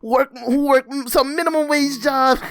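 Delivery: high, loud, laughing indignation; laughing rant. Real, not acted. Vlogger ranting at McDonald's charity ask, high laughing outrage.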